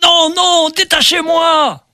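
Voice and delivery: high anguished cry; desperate shout. Acted. Tied Ulysses begs to be freed.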